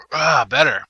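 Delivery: normal talking voice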